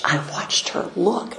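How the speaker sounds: ordinary delivery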